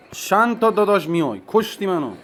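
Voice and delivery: high, loud frustrated outcry; exasperated shout. Real, not acted. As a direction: yell at the screen in disbelief. Frustrated at opponent's push. (داد کلافه و عصبی)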